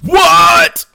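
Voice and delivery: high, loud startled disbelief; shocked what. Real, not acted. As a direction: jump at the ghost story. Reacting to the moving can.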